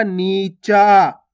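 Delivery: both vowels of नीचा held long; stretched vowels. Shown as a demonstration. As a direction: draw out नीचा, nee-chaa. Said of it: "आ की मात्रा के साथ खींचेंगे". (नीचा के दोनों स्वर खींचकर)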